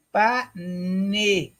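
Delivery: "Pane" said clearly, with even weight on both syllables; syllable drill. Shown as a demonstration. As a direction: model "Pane" slowly for the class to repeat. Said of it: "Repitam comigo".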